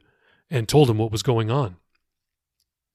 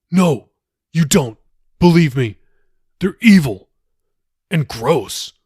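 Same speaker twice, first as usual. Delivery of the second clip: Jacob insists, louder and emphatic; urgent warning; 3 semitones higher, 7 dB louder than usual. Acted. Jacob warns the pastor about monsters, emphatic and louder. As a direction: press the warning hard, louder.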